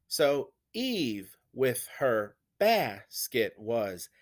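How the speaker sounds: very slow, "Eve" and "bells" hit hard, each followed by two light syllables; scanning the dactyls. Shown as a demonstration. Teacher scanning Eve for the class, beats stressed slowly. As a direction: beat it out, DUM-da-da, slowly. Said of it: "stressed syllable followed by two unstressed".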